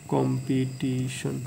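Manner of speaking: Competition slowly in four beats, কম, পি, টি, শন; syllable by syllable. Shown as a demonstration. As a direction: sound out each syllable of competition. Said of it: "চারবারে আমরা ভাঙাইতেছি". (Competition ধীরে চার ভাগে বলা)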